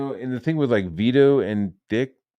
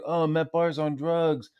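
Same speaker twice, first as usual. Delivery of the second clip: higher-pitched mimicry of gossiping critics; mock accusers; 6 semitones higher, slower than usual. Streamer mimicking people saying he's on drugs, mock accusation. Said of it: "being like".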